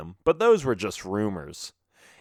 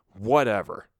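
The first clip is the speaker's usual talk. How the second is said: plain reading voice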